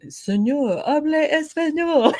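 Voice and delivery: high, lightly voiced Spanish teacher. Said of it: "my Spanish going".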